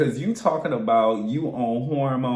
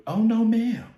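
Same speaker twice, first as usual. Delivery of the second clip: sassy no ma'am; 12 semitones higher, 4 dB louder than usual. Retold or imitated. Sassy refusal.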